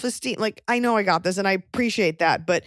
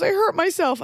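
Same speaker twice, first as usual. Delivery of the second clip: high, pitiful mock whimper; mock-tearful high voice; imitated crying mom; 11 semitones higher than usual. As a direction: play a hurt mother, voice pitched way up. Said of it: "high-pitched noises".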